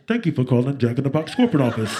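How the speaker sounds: quick, loud corporate hotline voice; imitated switchboard patter; phone greeting. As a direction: rattle off a scripted company greeting. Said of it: "all I hear is".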